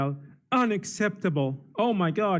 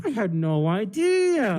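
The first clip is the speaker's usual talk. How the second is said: fake innocence; 2 semitones higher, slower than usual. Sarcastic feigned shock.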